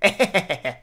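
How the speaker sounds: short delighted laugh; audience laughing. Retold or imitated. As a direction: laugh like an easily amused crowd. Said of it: "And then the audience is like".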